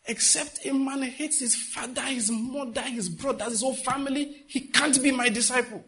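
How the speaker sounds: high, insistent divine pronouncement; voice of the Lord. Retold or imitated. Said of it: "the word of the Lord came to me saying".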